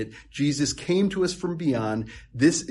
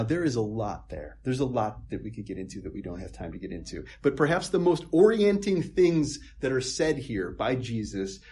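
normal talking voice

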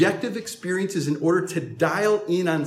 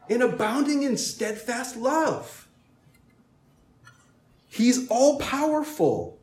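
preaching; 8 semitones higher than usual. Acted. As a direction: proclaim each attribute. Listing God's attributes.

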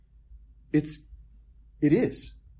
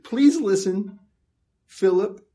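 louder, pleading voice of Jesus to Philip; 6 semitones higher, 4 dB louder than usual. Retold or imitated. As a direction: urge Philip earnestly to listen. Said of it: "he's saying".

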